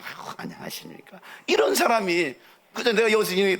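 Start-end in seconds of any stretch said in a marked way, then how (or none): 0.0-1.2 barely voiced, very soft shy hello; embarrassed, almost whispered politeness; bashful greeting. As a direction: shrink into a hushed greeting. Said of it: "너무 부끄러워가지고".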